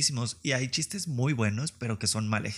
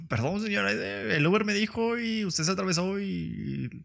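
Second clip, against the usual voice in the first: higher, sheepish fake apology; mock apology; 8 semitones higher than usual. Apologizing to the old lady he hit, mock sheepish. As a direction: apologise like a guilty kid.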